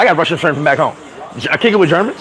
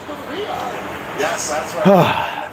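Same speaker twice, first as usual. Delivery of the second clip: sighing laugh; 7 dB quieter than usual. Real, not acted. Sigh-laugh.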